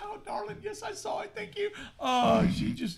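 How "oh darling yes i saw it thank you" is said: high, soft, strained mock politeness; comically timid thanks; meek placating husband. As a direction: humor an excited wife through the pain. Husband humoring his excited, grabbing wife.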